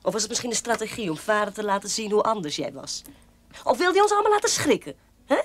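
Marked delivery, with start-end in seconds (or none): 3.6-5.4 much higher and louder, taunting; mock him with a high jeering question; mocking jab. Zus bespot broer. (spottend en hoog tegen haar broer)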